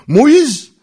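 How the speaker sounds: name called out high and loud; preaching shout. Acted. Preacher cries out Moses' name to his congregation. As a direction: ring the name out over the church.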